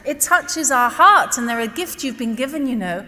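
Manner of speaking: normal talking voice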